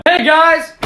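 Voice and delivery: loud, high hello shout; calling out. Real, not acted. [name] bursting in and loudly greeting his friends. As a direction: call across the room to the group.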